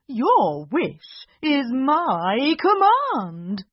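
slow, loud, formal genie; bow and declare it; grand genie. Acted. Genie obeys.

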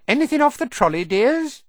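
high, loud sing-out to customers; trolley lady calling. Acted. Smiling woman offering snacks at the compartment door, calling out. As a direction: call it out high like a snack seller.

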